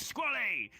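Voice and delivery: high shouted name; battle call. Retold or imitated. Orc calls his Viking ally during the bandit fight, high shout. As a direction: call out to him across the fight.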